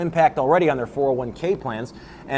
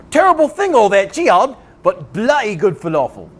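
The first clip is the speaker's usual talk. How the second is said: high, loud, bad Cockney; hacky Cockney accent; 5 semitones higher, 4 dB louder than usual. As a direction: put on a cheap Cockney English accent. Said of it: "as far as hacky English accents go".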